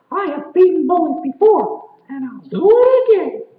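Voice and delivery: a bold hero stepping forward; high, loud cartoonish bravado; brave woodcutter. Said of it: "he said".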